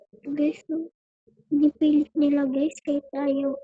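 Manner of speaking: hushed, soft hiding voice; whispering while hiding. Real, not acted. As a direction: keep it down so they don't hear. May tao dito.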